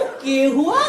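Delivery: mocking Haryanvi echo, rising question; Haryanvi नकल. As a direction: throw it back mockingly in Haryanvi. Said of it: "वो बंदा मुझे देखते ही हंस दिया". (हरियाणवी में मज़ाक उड़ाते हुए)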